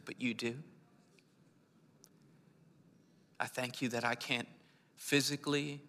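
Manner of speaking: prayer. Real, not acted. Pastor praying peace over the congregation, softly. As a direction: pray gently over them.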